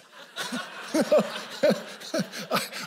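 high, wheezy burst of laughter; laughing. Real, not acted. Pastor after teasing his buddy from the stage, laughing. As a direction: laugh at your own tease.

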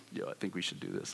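hushed whisper to his friend; conspiratorial whisper. Retold or imitated. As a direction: whisper it so the stranger can't hear. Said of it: "we're both kinda like".

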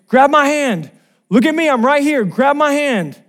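high, loud rescuer's call; urgent yelling. Retold or imitated. Lifeguard getting closer to the swimmer, urgent high yell. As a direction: shout commands to someone in the water. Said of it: "he keeps yelling to my brother Eric".

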